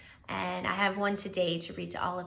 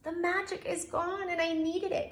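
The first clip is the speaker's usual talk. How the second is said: sad bear; 8 semitones higher than usual. Acted. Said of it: "cried Bear".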